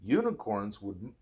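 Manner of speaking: ordinary delivery, nothing marked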